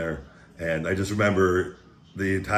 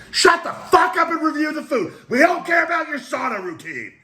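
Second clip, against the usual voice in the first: much higher, louder heckling voice yelling at him; mock heckler; 5 dB louder than usual. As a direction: play an impatient viewer telling him off. Mock heckler yells at himself.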